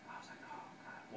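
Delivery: hushed mock dread under the breath; whispered dismay. Retells his dismay while sprinting back.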